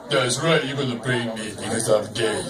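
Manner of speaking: very low, soft mumbling Rocky. Retold or imitated. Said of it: "And he'd be like".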